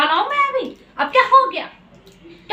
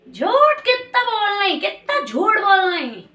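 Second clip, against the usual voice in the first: slow, drawn-out accusation; accusing; 5 semitones higher, slower than usual. Acted. Accusing the partner of lying about loans, drawn-out and accusing. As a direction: stretch the words in scornful disbelief.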